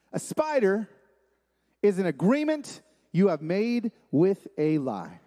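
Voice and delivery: deliberate, spaced phrases with pauses; slow definition. Real, not acted. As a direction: spell out the definition slowly. Definition given slowly, then repeated.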